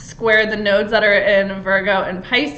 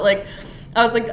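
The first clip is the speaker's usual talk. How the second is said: normal talking voice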